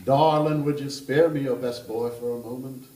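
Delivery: put-on a charming Kentucky gentleman teasing the table, Kentucky accent; playful Southern Kentucky voice, loud start. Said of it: "I'm going to attempt that kind of accent".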